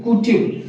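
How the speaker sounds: कुटिव as 'koo-tiv', no y glide; common mispronunciation. Shown as a demonstration. Teacher imitates most people, mispronounced. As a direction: say कुटिव with a plain 'koo'. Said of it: "ज़्यादातर लोग बोलेगा".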